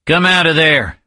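high called-out shout. Acted. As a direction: call out to someone hiding. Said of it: "making his voice loud enough to be heard".

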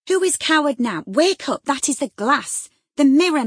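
high, loud wake-up shout; urgent shout. Acted. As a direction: shake her awake with your voice. Nelly shaking Catherine awake.

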